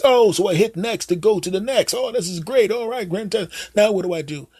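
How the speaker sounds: put-on high, hesitant old dad voice; clueless dad. Dad calling his son Jim for phone help, high and unsure. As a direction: play the fumbling father asking for help. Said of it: "You go up to them".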